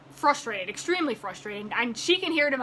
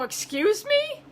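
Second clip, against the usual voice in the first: high, slow, offended; indignant excuse me; 11 semitones higher, much slower than usual. Retold or imitated. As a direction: drag it out in disbelief. Offended reply to agent.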